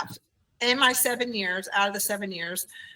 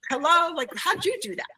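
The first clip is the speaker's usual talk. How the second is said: put-on puzzled child; 8 semitones higher than usual. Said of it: "he's looking at me like".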